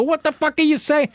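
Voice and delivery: mild mock frustrated outburst. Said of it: "I would have got frustrated".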